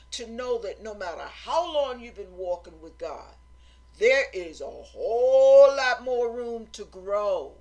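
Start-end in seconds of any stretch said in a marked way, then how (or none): 4.0-7.5 loud, slow, stretched emphasis; emphatic preaching. Real, not acted. Preacher urging humility to congregation, loud drawn-out emphasis. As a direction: belt out the long "whole".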